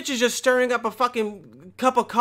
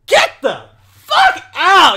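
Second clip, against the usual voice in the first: higher and louder, slow yelled dismissal; shout; 9 semitones higher, 10 dB louder, slower than usual. Real, not acted. Ranting at the girl in the video, a loud slow yell. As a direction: yell her out of France.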